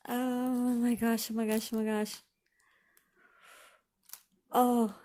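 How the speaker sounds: tearful. Real, not acted. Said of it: "Yeah, I'm crying".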